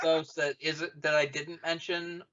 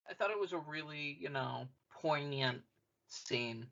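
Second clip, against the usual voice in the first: usual speaking voice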